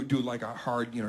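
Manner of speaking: ordinary talk, nothing marked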